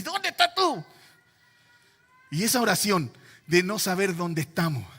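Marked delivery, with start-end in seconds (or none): 0.0-0.8 high-pitched, insistent divine question; God calling out. Acted. The Lord interrupting a wailing prayer, calling out high. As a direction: cut in as God calling the believer. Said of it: "Y el Señor dice".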